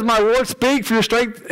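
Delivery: My Lord speak rushed together too quickly; rushed reading. Shown as a demonstration. Preacher reading Daniel's words to congregation, rushed. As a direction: hurry through the quote by mistake. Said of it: "sorry, I said that too fast".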